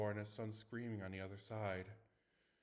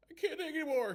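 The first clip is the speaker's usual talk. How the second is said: much higher, louder panicked wail; 9 dB louder than usual. Acted. Panicked boy wailing to his friends, afraid. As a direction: break down in terror. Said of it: "he wailed".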